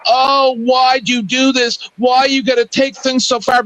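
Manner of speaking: put-on wail, mocking the sore loser; mock crying. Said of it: "they're fucking crying and going".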